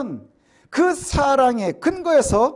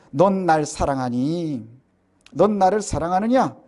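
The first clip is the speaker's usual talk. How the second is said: tender question; 7 semitones lower than usual. Retold or imitated. Jesus asking Peter tenderly.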